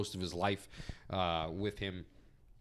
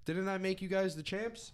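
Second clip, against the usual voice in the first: imitating Sherry; 9 semitones higher than usual. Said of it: "Sherry talking to Harlem Heat and saying".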